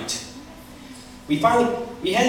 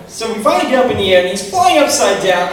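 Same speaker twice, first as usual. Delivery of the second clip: usual speaking voice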